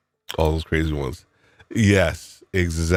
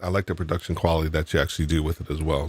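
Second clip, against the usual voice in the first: normal talking voice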